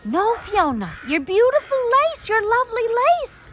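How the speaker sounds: high, loud, panicked wail; frantic sister shrieking over the lace; hysterical scream. A narrator's reading. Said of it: "Eilish screamed as she became hysterical".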